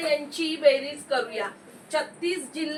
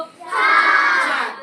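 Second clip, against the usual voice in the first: emphatic answer; 2 semitones higher, 7 dB louder, much slower than usual. Real, not acted. Teacher answering count to class.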